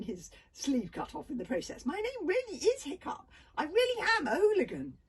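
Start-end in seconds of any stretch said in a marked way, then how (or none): 1.9-5.0 loud, high pleading insistence; desperate insistence. A narrator's reading. Hiccup insisting to the girl who he is, loud and high.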